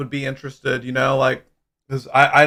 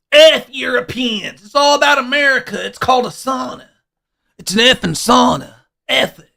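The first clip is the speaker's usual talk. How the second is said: hammed-up American patriot; 7 semitones higher than usual. Mock American patriot rant.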